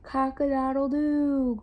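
slow, drawn-out storybook crow; rooster's crow. A narrator's reading. Reading the rooster to kindergartners, long slow crow. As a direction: crow slowly, stretching the last note. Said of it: "crowed the rooster".

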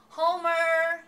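loud, high drawn-out call; high, sustained summoning shout. A narrator's reading. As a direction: holler the name to someone across the house. Wife calling out to husband.

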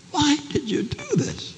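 high, bewildered pleading; pleading question. Acted. Rescued wife asking her husband why, high pleading question. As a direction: ask it high, needing an answer.

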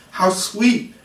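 delighted cry. A narrator's reading. Wendy delights at Peter flying, high delighted cry. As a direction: gush with delight. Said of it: "cried Wendy".

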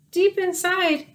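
usual speaking voice

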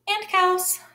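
a high, odd put-on voice for the cow; high silly cow voice. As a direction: chirp it like the cow. Cow's added sign voiced.

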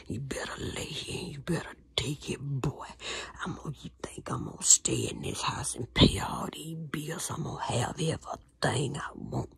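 hushed threatening whisper; menacing whisper. Retold or imitated. Abuser threatening the boy in a whisper. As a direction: whisper the orders coldly.

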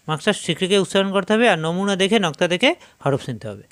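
normal talking voice

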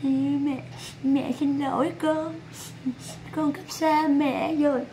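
soft, slow, put-on weepy apology; hammed-up sobbing mother's farewell, hushed; mock tearful mother. Mocking a mother apologising to her child on stream, mock tearful.

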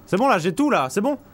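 loud, high, relieved; excited relief. Real, not acted. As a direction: cry out in relief. Relieved, everything collected.

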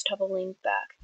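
ordinary delivery

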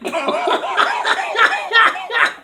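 very high squealing cackle; giddy high-pitched cackle; squealing laugh. Real, not acted. Cackles at his own show.

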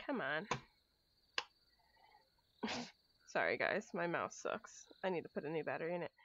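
usual speaking voice

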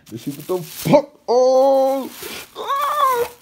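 very high, loud squeal; surprised yelp. Real, not acted. Vlogger squealing at new sneakers, very high and loud. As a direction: shriek with delight.

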